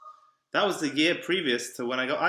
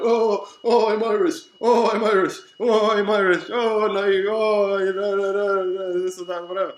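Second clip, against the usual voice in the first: mocking impostor; 5 semitones higher, 8 dB louder than usual. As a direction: mimic the impostor with loud scorn.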